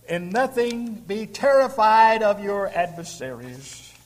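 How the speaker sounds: loud, slow, ringing scripture quote; fiery preacher proclaiming the verse; preaching at full force. Acted. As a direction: thunder the verse out to the congregation.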